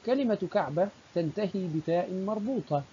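usual speaking voice

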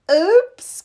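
high, surprised oops; startled little oops. A narrator's reading. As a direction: caught out, pitch jumps up. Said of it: "cried Froggy".

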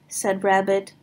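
plain reading voice

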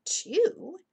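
high, loud puzzled echo; incredulous question. A narrator's reading. Annie questioning Jack's order, incredulous. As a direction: throw it back in disbelief.

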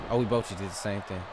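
usual speaking voice

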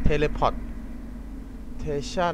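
"teleportation" stretched very slowly; drawn-out word. Pretend. Reads game word aloud drawn out. (ลากเสียงคำว่า "teleportation" ยาวช้ามาก)

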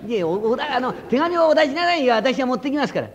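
高い声で勧める小僧; 高めの声で調子よく勧める小僧; 小僧の声. Acted. 小僧が隠居に勧める. (高い声で手紙を勧める小僧)

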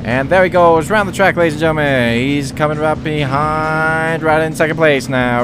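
low, fast announcer patter; race commentator. Pretend. Gamer narrating the race for pretend TV viewers. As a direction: hype the race like live TV.

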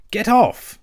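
high, loud protest through food; spluttering. A narrator's reading. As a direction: splutter it out with your mouth full. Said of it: "spluttered the rat, with his mouth full".

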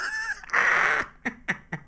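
falsetto laughing fit; high squeal of laughter. Real, not acted. Beard glitch in game.

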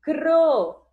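high, loud syllable cro; syllable called out. Shown as a demonstration. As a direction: call out cro as one clear beat.

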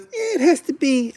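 high, drawn-out mock objection; mocking YouTube executives. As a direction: imitate a fussy executive, high and slow. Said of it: "and they're like".

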